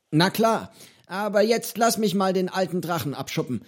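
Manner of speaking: put-on a cheerful eccentric old man offering fish; old pirate Johnny. Old pirate cook to hungry boy, cheerful and high.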